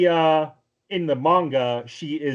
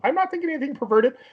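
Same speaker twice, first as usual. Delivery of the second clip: much higher, flustered protesting voice; high-pitched nervous backpedalling; panicked excuses; 13 semitones higher, faster than usual. Retold or imitated. Tomoya caught touching a kid insists it's fine, high panicky voice. Tomoya denies being a pervert.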